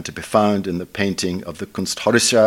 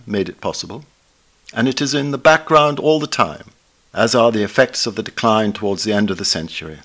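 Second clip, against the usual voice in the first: ordinary talk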